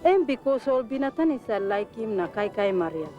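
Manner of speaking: ordinary talk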